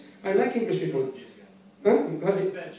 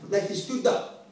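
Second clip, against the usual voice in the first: usual speaking voice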